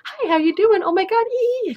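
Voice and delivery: high, loud, squealing perky blonde; perky fifties girl. Acted. Woman voices her cheery imagined alter ego, perky and high. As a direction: put on a bubbly, brainwashed fifties girl. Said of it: "blonde, perky fifties girl".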